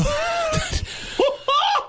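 sudden high shrieking laugh; burst of laughter. Real, not acted. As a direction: crack up at the punchline.

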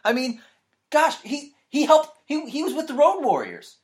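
high-pitched, exasperated defence; indignant protest. Real, not acted. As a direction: plead indignantly, voice climbing high.

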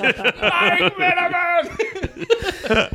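very slow, high, laughing name; drawn-out rueful name. Retold or imitated. Comic at the airport groaning his mentor's name, slow and laughing. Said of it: "I'm just".

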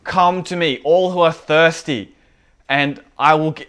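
high, loud proclamation; imitating a preacher's booming public shout. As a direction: call it out across a crowded feast. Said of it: "and he shouts".

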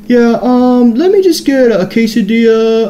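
imitating a customer playing to the room, high-pitched; show-off customer. Beanie kid takes the stage to order, showing off. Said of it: "he's got the fucking stage now".

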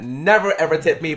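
ordinary talk

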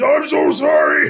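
high, loud, slow hammed-up sorry; mock apology shout. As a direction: yell the apology like a guilty ghost. [name] apologizing after the blast.

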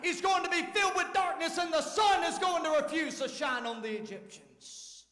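preacher thundering the story; preaching at full force. Acted. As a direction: drive the sermon home.

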